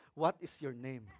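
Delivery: soft, low deadpan comeback; calm reply. Pretend. Answering a yelling spouse, low and calm. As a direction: drop low and quiet against the yelling.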